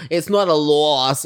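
slow, loud, put-on dismissive voice; mock-haughty imagined retort; imitating Jackie.

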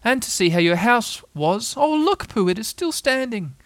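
high little pig, delighted; Piglet. A narrator's reading. Little pig sees the house standing, high excited voice. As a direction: pipe up high with pleased surprise.

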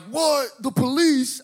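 high-pitched mock panic; panicked reaction. High teen hearing police at a party, panicked yelp. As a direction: yelp it in alarm.

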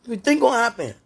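frustrated loud challenge; exasperated. Real, not acted. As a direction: press the question loudly, fed up. Same question demanded once more.

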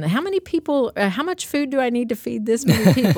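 imitating a friend's question; lightly voiced friend asking. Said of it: "she kinda calls me".

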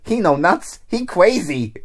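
high mock-Chinese child's voice; semi-Chinese kid's voice. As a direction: imitate a kid with a put-on Chinese accent. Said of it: "semi-Chinese kid's voice".

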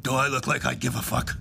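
a hint of a menacing biker growling; lightly voiced tough biker threatening; mild growl. Scary biker growling into the narrator's face, mild menace. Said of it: "he growled right into my face".

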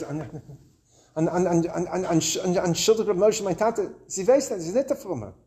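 stammering, soft start into querulous pleading; halting querulous Yiddish. Retold or imitated. Embarrassed boy apologizing to the rabbi for his aunt, halting querulous Yiddish. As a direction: imitate a mortified boy stumbling through Yiddish. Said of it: "he crawls over in his halting Yiddish, querulous Yiddish".

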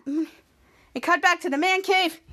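ordinary delivery

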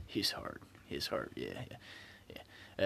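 laughing whisper. Real, not acted. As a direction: drop to a giggling whisper. Joking aside about Abe.

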